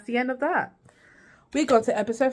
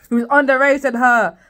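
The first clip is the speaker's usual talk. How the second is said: raised, emphatic outburst; loud disapproval; 5 semitones higher, 11 dB louder than usual. Real, not acted. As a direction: say it louder, appalled. Shocked aside about the age gap.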